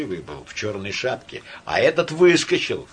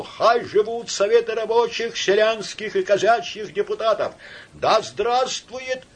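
crying out slogans; 9 semitones higher than usual. A narrator's reading. Orator who has mastered the masses, crying out. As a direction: hail the soviets to the crowd. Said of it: "в ближайших рядах вскрикивал".

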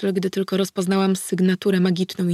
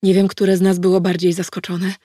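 ordinary delivery, nothing marked

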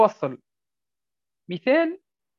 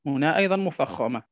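ordinary delivery

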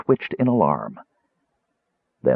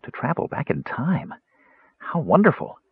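sighing; 7 semitones higher than usual. A narrator's reading. Kender dreaming of travelling back in time, sighing. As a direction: sigh dreamily in a higher voice. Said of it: "the Kender sighed".

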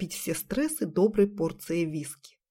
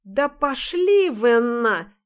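slow, slurred louder swearing; drunken cursing; 4 semitones higher, 4 dB louder, much slower than usual. Acted. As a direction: drag out a sloppy drunk curse. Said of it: "Грязно выругавшись".

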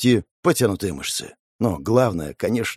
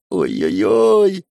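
slow, drawn-out whimper; whimpering in pain; 2 semitones lower, much slower than usual. A narrator's reading. Pyromancer moved his wounded arm, whimpering. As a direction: moan at a hurt arm, stretched out. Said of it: "тихонько заскулил от боли".

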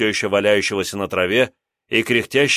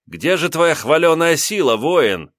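mocking taunt; 6 semitones higher, 3 dB louder, slower than usual. Narrator taunts the bully.

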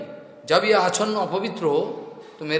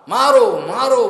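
louder, higher shouted chant; battle cry; 4 semitones higher, 6 dB louder, slower than usual. Retold or imitated. Demons falling upon the gods, retold battle cry. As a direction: cry it out like a charge. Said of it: "बोल के".

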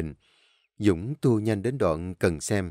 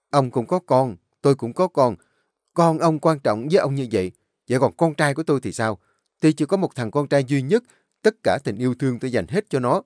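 louder, higher angry rant; furious outburst; 4 semitones higher, 5 dB louder, faster than usual. Acted. Enraged father rages at kidnapper's husband, loud furious rant. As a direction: tear into him, fast and loud.

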